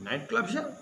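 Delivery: high, climbing surprise on "here"; astonished question. Retold or imitated. Retelling the Pope's reply to a reporter, astonished question. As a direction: pitch leaps up in wonder. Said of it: "आश्चर्य सूचक था".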